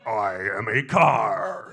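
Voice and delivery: slow, low start swelling loud and rising; talking car. Acted. Misfit voice introducing itself, slow swelling car character. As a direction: announce yourself as a talking car. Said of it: "voices that didn't fit anywhere".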